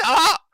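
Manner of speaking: hammed-up high, loud shriek; a short, piercing fake scream; mock shriek. Commentator mocking the protester's screech, high mock shriek. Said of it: "Can you hear him screeching?".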